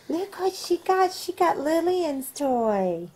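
gasp, then high and slow; excited at what she found; surprised. Real, not acted. Gasps at the baby's find.

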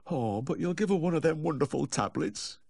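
high, wheedling plea; imploring high whine; pleading. A narrator's reading. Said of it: "he pleaded".

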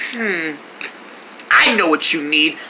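ordinary talk

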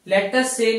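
usual speaking voice

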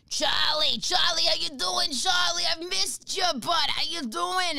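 put-on high, sing-song old lady. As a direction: call out sweetly like an old lady. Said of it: "she's like".

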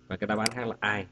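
normal talking voice

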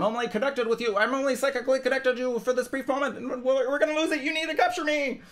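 high, urgent voice of a song begging to be recorded; pleading song. Acted. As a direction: plead in a very high, rising voice, afraid of losing the moment. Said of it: "the song will will me to stay".

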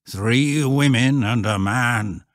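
slow, drawn-out rustic pronouncement; ominous old shepherd. Acted.

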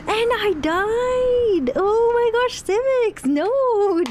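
high loud wailing dismay; real anguished yell; distressed yelling. Real, not acted. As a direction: cry out at the death. Character dies in game.

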